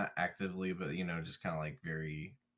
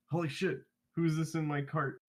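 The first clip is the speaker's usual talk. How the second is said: higher-pitched startled exclamation; startled awake; 5 semitones higher than usual. Acted. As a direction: jolt awake, alarmed at a stranger. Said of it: "with a start".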